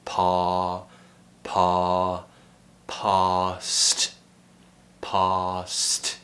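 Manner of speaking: "Pa" and "past" with a long open back ah. Shown as a demonstration. Teacher drills learners with a P before, long open ah. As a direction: stretch the ah in "past", throat open. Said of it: "Now try it with a P before".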